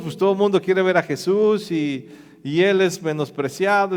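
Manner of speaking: usual speaking voice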